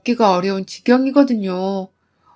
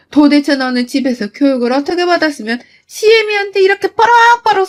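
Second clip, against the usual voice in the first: shrill mother-in-law outrage; 6 semitones higher than usual. Acted. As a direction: scream back like an insulted mother-in-law. Mother-in-law shouting back. (며느리에게 모욕당한 시어머니가 높고 크게 호통치듯)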